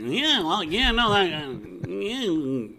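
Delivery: high, mumbling mock announcer voice; Aaron Boone. Co-host mocking an analyst's rambling thoughts, high-pitched imitation. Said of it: "Aaron Boone with his high-pitched voice".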